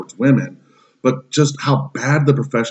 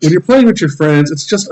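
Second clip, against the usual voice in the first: ranting call-out; 6 semitones higher than usual. Real, not acted. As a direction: scold them straight out, fed up. Ranting at toxic gamers.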